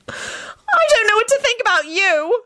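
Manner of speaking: high, drawn-out tearful screech. A narrator's reading. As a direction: screech it slowly at the top of your range. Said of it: "she screeched at Henry".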